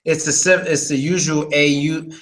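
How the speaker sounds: usual speaking voice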